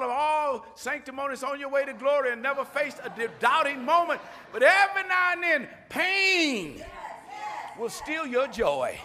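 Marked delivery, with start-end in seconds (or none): 4.5-9.0 preaching cry. Real, not acted. Pastor to congregation, cries out about pain.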